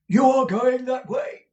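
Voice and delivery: high, loud theatrical manager voice; booming stagey manager asking; Mr Crummles the manager. A narrator's reading. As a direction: play the bluff old actor-manager.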